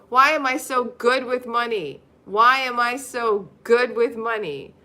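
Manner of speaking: very slow, loud proclamation; emphatic affirmation. Shown as a demonstration. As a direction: chant it slowly and proudly. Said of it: "repeat after me".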